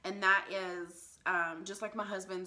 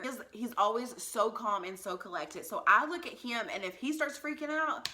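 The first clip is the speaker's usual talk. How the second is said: normal talking voice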